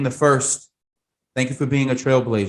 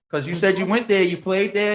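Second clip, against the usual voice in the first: ordinary talk, nothing marked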